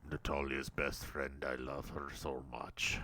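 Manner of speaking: very low, hushed Russian-character voice; put-on character voice. Host recapping an episode's Russian characters, low put-on voice. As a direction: drop far down into a soft, deep put-on voice.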